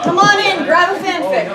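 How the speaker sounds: loud, high welcome to the room; calling out. Real, not acted. Host calling arriving fans inward, loud and bright. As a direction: call the crowd in cheerfully.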